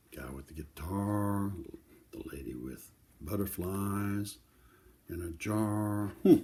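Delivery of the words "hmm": plain reading voice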